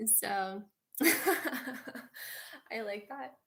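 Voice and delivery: slow, giggling through it; laughing. Real, not acted. Vlogger laughing about her favourite movie quote. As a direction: let the laugh carry the words.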